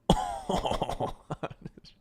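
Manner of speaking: French laugh. Shown as a demonstration. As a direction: demonstrate a hammy French laugh. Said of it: "the key to the perfect French accent".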